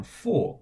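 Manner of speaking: 付 starting high and falling sharply; fourth tone. Shown as a demonstration. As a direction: drop hard from high on 付. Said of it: "F U in the fourth tone".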